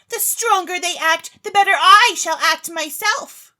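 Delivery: put-on high, loud, gleeful young lady; Claribel's boast. Claribel boasts to the chemist, high and loud. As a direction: crow with confidence.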